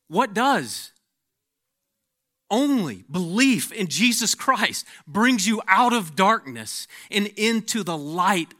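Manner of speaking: loud, high, forceful preaching; fiery preacher pressing each claim. Acted. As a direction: drive the warning home from the pulpit. Opening warning, preached at full force.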